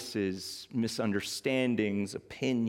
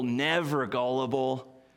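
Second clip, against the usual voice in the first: ordinary talk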